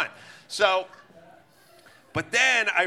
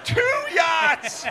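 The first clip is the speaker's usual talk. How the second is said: high, loud shout of disbelief; 11 semitones higher, 4 dB louder than usual. Retold or imitated. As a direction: yell it, stunned. Repeated in disbelief.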